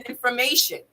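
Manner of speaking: ordinary delivery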